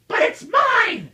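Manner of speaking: very high, loud possessive scream. A narrator's reading. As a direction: shriek it as the greedy bird. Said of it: "screamed the bird".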